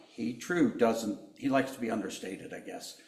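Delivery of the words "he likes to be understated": usual speaking voice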